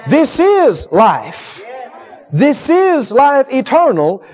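slow, proclaimed emphasis; slow fervent declaration; slow proclaiming. Real, not acted. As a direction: lean on every word like a revelation. Preacher climax.

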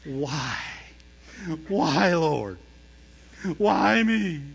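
drawn-out pleading cry to heaven; mock conviction. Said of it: "They'll do it with conviction".

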